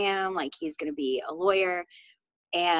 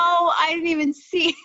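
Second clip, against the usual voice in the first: surprised laugh; 10 semitones higher, 5 dB louder than usual. Real, not acted. Gets the joke late.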